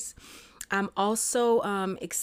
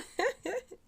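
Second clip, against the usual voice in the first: joyful laugh; 4 dB quieter than usual. Real, not acted. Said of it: "pure joy in my voice".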